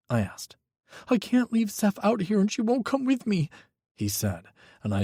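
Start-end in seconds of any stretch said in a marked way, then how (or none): 1.1-3.5 put-on high, tense young voice; a frightened young man pleading on the phone; young man, scared. A narrator's reading. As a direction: Micah telling Hawk his ex is dangerous, high and scared. Micah's voice, near tears.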